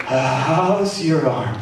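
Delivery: drawn-out, slow "how's your arm" with a laugh; mock-dazed preacher checking the arm, slow and laughing; exaggerated preacher ask. Shown as a demonstration. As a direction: ham up the slow, dazed question. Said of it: "I was exaggerating, a preacher exaggeration".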